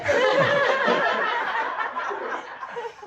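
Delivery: long breathy burst of real laughter. Real, not acted. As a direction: laugh out loud at the memory. Laughs at his own story.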